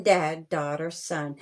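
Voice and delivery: plain reading voice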